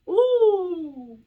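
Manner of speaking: a sudden high, loud "Ouh"; high excited hoot. Real, not acted. Pleased with her English reading, a high excited hoot. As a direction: let out a delighted whoop at her own accent.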